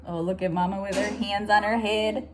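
ordinary delivery

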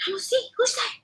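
high squeaky voice for the dog; pet voice. Real, not acted. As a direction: go all squeaky, talking to the pet. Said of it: "that was my pet voice".

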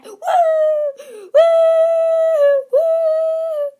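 wild triumphant shriek; ecstatic whoop. Acted. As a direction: let out a huge victory yell. Arrival celebration.

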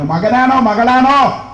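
high, drawn-out teasing question; joking question. Retold or imitated. Women teasing the pregnant mother about son or daughter, joking. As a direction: tease her, stretching the question up high. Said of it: "തമാശ പറഞ്ഞതാ".

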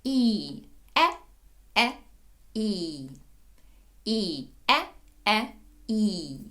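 drawn-out І against short е, each separate; warm-up drill, long І and short е; long-short vowel drill. Shown as a demonstration. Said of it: "Розминаємося, розігріваємося". (вправа: довге «І», коротке «е»)

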